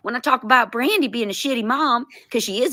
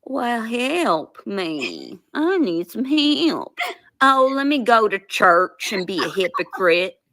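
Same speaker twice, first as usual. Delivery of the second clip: mocking Southern accent; 3 semitones higher than usual. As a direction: parody a churchgoing Southerner's talk. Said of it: "This is how I hear you talk".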